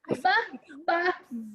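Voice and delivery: ordinary delivery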